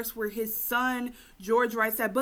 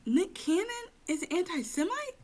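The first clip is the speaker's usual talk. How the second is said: mock surprise; 11 semitones higher than usual. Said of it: "deleted scenes where he's like".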